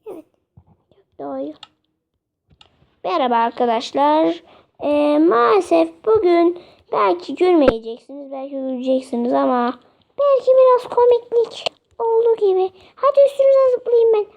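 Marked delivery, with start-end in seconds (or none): none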